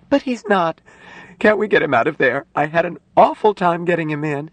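high, pleading woman's voice; distressed young nurse. Acted. Nurse begs doctor to get patient out, pleading. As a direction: protest urgently in a high voice.

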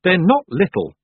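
sudden sharp objection; snapping. A narrator's reading. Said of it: "Tiffany snapped, louder than she'd meant to".

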